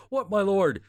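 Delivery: hammed-up follower calling back to his lord; eager servant. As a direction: shoot the answer up high. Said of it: "like, in that joke".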